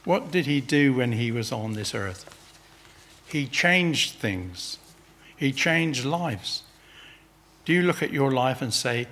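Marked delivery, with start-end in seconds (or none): 3.3-6.6 He changed things, He changed lives, each word slow and weighted, changed stressed; slow emphasis. Acted. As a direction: lean on changed, very slowly, word by word. Preacher hammering home the point.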